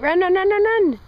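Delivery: high excited cheering; yelling from the sidelines. Real, not acted. Urging toddler to run.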